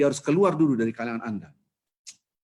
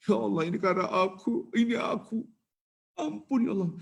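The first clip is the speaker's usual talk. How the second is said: repentant plea; 9 semitones higher than usual. Acted. Sinner repenting in his heart.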